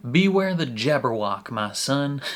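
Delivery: hammed-up Southern title read; southern accent. Real, not acted. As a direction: announce the title with a Southern twang. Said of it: "I don't know why I'm speaking in a southern accent".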